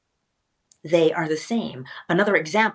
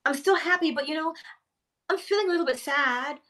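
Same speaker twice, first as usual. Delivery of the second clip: high and mildly wistful, sinking to a whispered "sad now"; a lightly acted slide from cheerful to sad; mock sadness; 7 semitones higher, 3 dB quieter than usual. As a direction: let the happiness drain away line by line. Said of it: "You're like".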